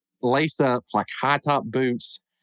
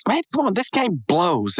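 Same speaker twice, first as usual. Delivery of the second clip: higher, slow whiny gripe; whiny complaint; 7 semitones higher than usual. Retold or imitated. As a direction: drag it out like a sulky kid. Rigby complains about game.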